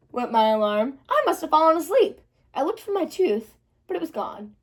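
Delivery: ordinary delivery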